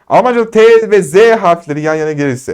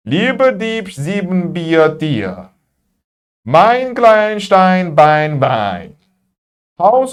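song-like word list; much slower than usual. Shown as a demonstration. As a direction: chant German words in falling strings. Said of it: "Şarkı gibi oldu".

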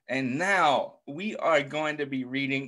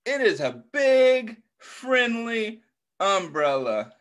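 storybook delight; 6 semitones higher, 3 dB louder than usual. A narrator's reading. Reading picture book to children.

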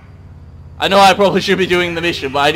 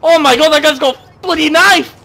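high, loud panic at the game; panicked gamer outburst; alarmed yell; 10 semitones higher, 9 dB louder than usual. Pretend. Player spots an attacker's knife.

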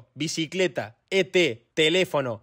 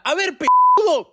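high, loud, drawn-out insult; exasperated yell; 13 semitones higher, 8 dB louder than usual. Acted. Instructor to a failing pupil, exasperated yell. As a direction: burst out, stretching the insult.

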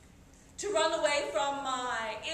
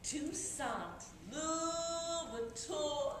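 soft, very slow, sing-song savouring; adoring; 3 semitones higher, 7 dB quieter, much slower than usual. Acted. Girl naming her secret lover, adoring. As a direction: linger dreamily over LOUVERTURE, softly.

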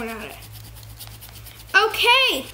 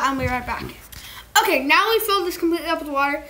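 normal talking voice

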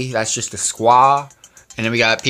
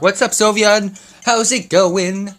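higher, louder, slow greeting; excited greeting; 9 semitones higher, 5 dB louder, slower than usual. Real, not acted. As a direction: call out a cheerful hello. Greeting a chat viewer.

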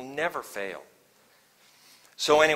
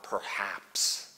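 hesitant whisper; 7 semitones lower, 11 dB quieter than usual. Pretend. Hushed quote of hesitant thought.

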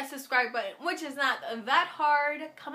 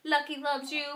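normal talking voice

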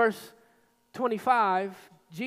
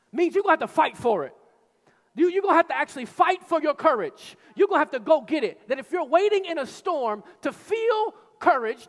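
loud, fast, high driving sermon; preaching at full force; 5 semitones higher, 4 dB louder than usual. Acted. Preacher urging the church to fight for courage, loud and driving. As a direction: push the congregation to fight.

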